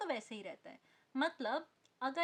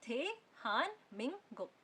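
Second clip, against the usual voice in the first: थे हान Ming गुक syllable by syllable; slow dictation. Shown as a demonstration. Teacher dictating slowly to someone, syllable by syllable. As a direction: separate each syllable, keeping n in हान. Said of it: "dictate कर रहे हो किसी को या धीरे धीरे".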